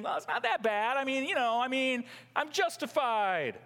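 high mock self-justifying voice; defensive excuses. Sinner redefining his sin, high mock-defensive excuses. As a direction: wriggle out of it, pitched high. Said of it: "trying to redefine the sin and saying".

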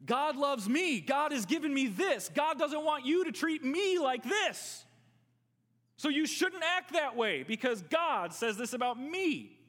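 loud, high indignant complaint; self-centred protest. Retold or imitated. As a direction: demand your rights, all about me. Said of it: "that's how they argue against".

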